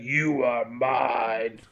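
very slow, low creature voice; ghoul voice. Retold or imitated. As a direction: drag the words out like a monster. Retold ghoul claiming a man.

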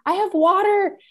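high, bright helpful stranger voice; kind stranger. Retold or imitated. Imitating the eager woman offering her a ride. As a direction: chirp it up like an eager helper.

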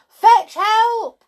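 very high, loud plea; panicked shout to a friend; desperate cry. A narrator's reading. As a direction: yell urgently for rescue. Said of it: "cried Tom".

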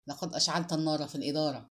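ordinary delivery

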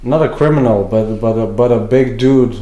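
ordinary talk

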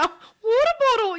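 high, loud mock Australian twang; mock Australian accent. Teasing a sister's bad accent, mock Australian accent. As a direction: parody a botched English accent gone Australian. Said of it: "you are Australian right now".

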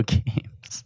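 slow, breathy joke whisper; mock whisper. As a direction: drop to a slow whisper for the punchline. Joking aside about playing alone.

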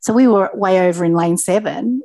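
ordinary talk, nothing marked

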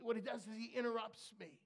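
usual speaking voice

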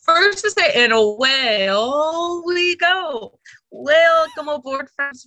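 bright ride-guide spiel, slow and high; storyteller voice. Shown as a demonstration. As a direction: announce it like a theme park skipper. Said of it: "in your storyteller voice".

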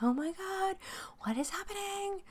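high, panicky "Oh my God"; mock panic. To listeners, imitating her own panic, high and frantic. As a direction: squeal it like you're freaking out.

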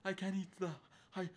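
high, loud words broken by fake sneezes; hammed-up allergy attack; mock sneezing fit. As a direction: struggle to finish through the sneezes. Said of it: "breaking out in sneezing fits".

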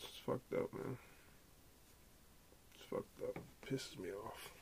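hushed and voiceless, almost whispered. Real, not acted. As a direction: drop to a bare voiceless hush, shaken. Upset over child shooting.

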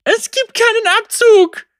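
high, loud, frantic cry; panicked girl screaming at the ghost. A narrator's reading. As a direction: she shouts in terror at the useless gun.

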